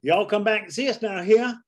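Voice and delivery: high Southern "y'all come back", stretched "here"; Southern drawl. Retold or imitated. A Southern shopkeeper sending a little kid off, drawling. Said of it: "the first time you ever hear".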